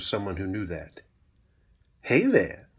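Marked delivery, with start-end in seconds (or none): 2.0-2.7 higher-pitched, sultry Southern greeting; sultry Southern accent. A narrator's reading. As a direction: greet him seductively with a Southern lilt. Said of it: "she said in a sultry Southern accent".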